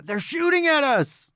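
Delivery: high-pitched alarm cry; panicked yell. Acted. Runner fleeing past bandmates, panicked high yell. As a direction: yell a warning while running in panic. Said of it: "in a panic".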